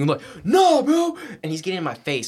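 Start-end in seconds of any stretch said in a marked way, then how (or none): 0.4-1.2 loud, high mock yell; retold screaming. Brother in his face during the fight, screaming. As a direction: imitate a brother yelling, pitched way up. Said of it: "He's screaming at the top of his lungs".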